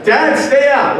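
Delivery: high, loud protest; comic yell to keep dad out; mock panicked son. Son warning dad off, mock panicked.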